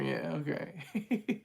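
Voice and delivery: trailing off into a real laugh; real chuckle taking over. Real, not acted. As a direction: let the words dissolve into giggles. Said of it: "There I go laughing again".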